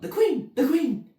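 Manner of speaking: loud high alarm; calling out. Acted. Gardener warns the others, loud high alarm. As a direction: shout a warning across the garden. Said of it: "called out".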